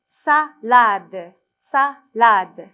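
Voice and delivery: "Salade" loudly split as sa, pause, lade; syllable-by-syllable reading. Shown as a demonstration. Teacher showing children the missing syllable, "Salade" split in two. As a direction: break "Salade" into two loud, separate chunks. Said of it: "c'est la syllabe sa".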